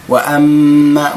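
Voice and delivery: وأما with the doubled meem held long and nasal; slow recited وأما. Shown as a demonstration. Teacher reciting Quran to students, slow held ghunna. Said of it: "مجددا ميم مشددة".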